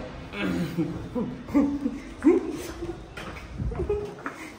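long laugh trailing down from high. Real, not acted. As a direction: give in to a real laugh. Hands over hidden carpet, laughing. (हँसी छूट जाना)

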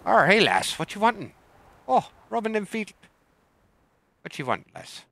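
high, loud hammed-up pirate; pirate hailing a lass, loud and high. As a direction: greet the lass like a rowdy pirate. Pirate greeting to NPC.

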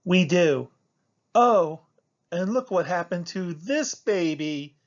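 high, loud exclamation, easing in volume; urgent cry. A narrator's reading. Clementine showing a friend the baby turtle, crying out. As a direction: cry out to draw attention to it. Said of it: "cried Clementine".

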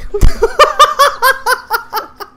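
high, loud burst of laughter; big high laugh. Real, not acted. Laughing at teammate's kill.

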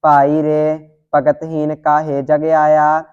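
ordinary talk, nothing marked

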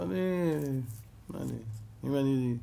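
hesitant, softly acted false starts; flustered stammer. Preacher voicing Jethro at Pharaoh's decree, flustered and halting. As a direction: stumble over the words, unable to finish.

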